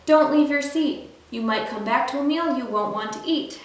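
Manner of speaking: usual speaking voice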